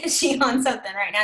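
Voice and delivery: mock shocked viewer. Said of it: "I've been like".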